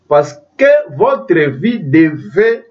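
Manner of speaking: ordinary talk, nothing marked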